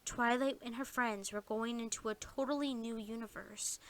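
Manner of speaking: plain reading voice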